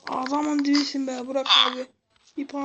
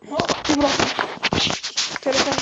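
loud alarmed call; 5 dB louder than usual. Real, not acted. Catching a falling phone.

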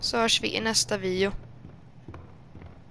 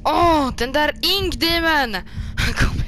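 slow, loud and higher outburst; excited dread; 5 semitones higher, 5 dB louder than usual. Real, not acted. Player spotting the Ink Demon, a slow loud outburst. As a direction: drag it out, half thrilled, half scared.

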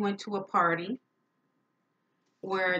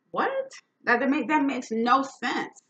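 much higher, incredulous What; shocked disbelief; 7 semitones higher, 4 dB louder than usual. Retold or imitated. Retelling her reply to the boyfriend, shocked.

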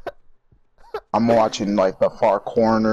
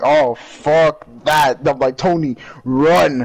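loud, drawn-out mock alarm; retold alarm; 5 semitones higher, 7 dB louder, slower than usual. Player explaining his scare to friend, loud retold alarm. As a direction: replay your own panic, stretching the words. Retelling his own panicked reaction.

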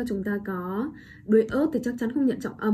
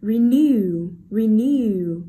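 renew with NEW punched loud; light re, then a stretched, louder NEW in renew; stress on second syllable; 6 dB louder, much slower than usual. Shown as a demonstration. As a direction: hit the end of renew hard. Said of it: "trọng âm rơi vào âm tiết số hai".

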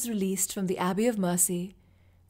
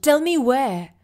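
loud, higher, wild-eyed bark; the paranoid king seizing on news; frantic demand; 5 semitones higher, 9 dB louder, slower than usual. Acted.

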